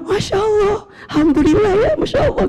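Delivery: feigned cheer for his wife's news; forced joy. Retold or imitated. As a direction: fake delight to hide his worry. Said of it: "Saya bilang".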